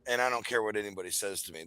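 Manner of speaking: ordinary delivery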